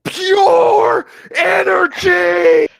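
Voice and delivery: screamed high and loud, drawn out; anime battle shout. Pretend. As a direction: bellow it like a hammy anime hero powering up. Mocking an overpowered anime attack.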